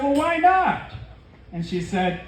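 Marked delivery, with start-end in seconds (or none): 0.0-0.9 high-pitched indignant question; mock protest. Man asks Monique why she refuses, high mock protest. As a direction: protest in a much higher voice.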